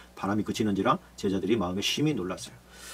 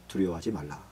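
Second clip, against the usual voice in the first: lower, much softer comfort; soft reassurance; 8 semitones lower, 9 dB quieter than usual. Acted. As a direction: say it gently, almost hushed. Jesus comforts quietly. (두려움에 빠진 이들에게 예수님이 낮고 부드럽게 건네는 말)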